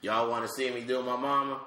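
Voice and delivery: ordinary talk, nothing marked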